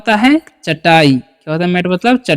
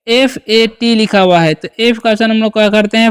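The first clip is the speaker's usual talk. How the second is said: usual speaking voice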